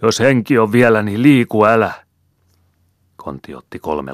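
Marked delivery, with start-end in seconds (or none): 0.0-1.9 much higher and louder, fleeing man's bellow; desperate roar. Acted. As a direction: scream it back over your shoulder as you flee. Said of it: "karjui suokerilleen". (epätoivoinen karjunta)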